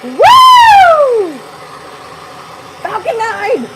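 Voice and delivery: excited cheer. Real, not acted. Cheering rocket launch.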